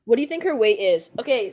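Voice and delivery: ordinary talk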